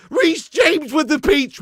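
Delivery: high, slow commentator shout; hammed-up commentator cry; mock commentary call. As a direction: call it like the goal replay.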